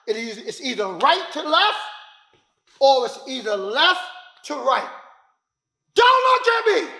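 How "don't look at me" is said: sharp high shout; sudden loud order, pitched way up; shouted command. Shown as a demonstration. Instructor demonstrates a distracting command, sudden high shout.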